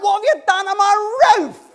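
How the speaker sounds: high, loud outraged yell with a laugh in it; a homeowner yelling at the men on his roof, half laughing; angry shout. Retold or imitated. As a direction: bellow up at the hole in your roof. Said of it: "is now shouting out".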